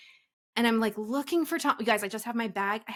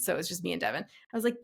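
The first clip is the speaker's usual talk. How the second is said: usual speaking voice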